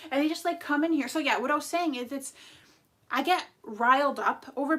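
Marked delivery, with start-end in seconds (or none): none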